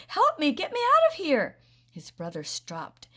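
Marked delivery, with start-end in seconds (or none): 0.1-1.6 loud, very high plea; panicked yell. A narrator's reading. Larry yelling for help with his shoe stuck. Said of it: "he yelled".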